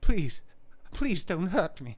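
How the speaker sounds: put-on scared victim begging; frightened pleading. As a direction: plead as if about to be hurt. Acted victim begging after villain voice.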